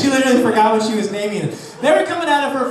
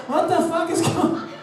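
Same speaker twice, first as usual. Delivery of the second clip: confused onlooker; 8 semitones higher, 3 dB quieter than usual. Retold or imitated. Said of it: "You'd be like".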